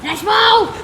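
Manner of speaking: loud, high shout; high-energy playful yell; excited urging. Real, not acted. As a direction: urge the dog on loudly. Urging the dog on in play.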